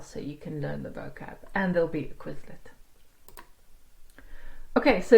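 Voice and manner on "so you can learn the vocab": whisper. Real, not acted.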